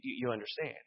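normal talking voice

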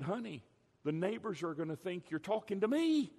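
mock worried complaint; wife's retort. Wife's retort to his screaming.

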